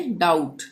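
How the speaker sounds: डाउट with no b before the t; silent B. Shown as a demonstration. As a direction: leave the b out of डाउट. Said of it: "हम बी का उच्चारण नहीं करेंगे".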